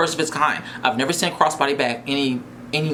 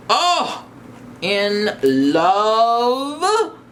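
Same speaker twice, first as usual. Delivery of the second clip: gushing delight; 6 semitones higher, much slower than usual. Real, not acted. Gushing over the new bag.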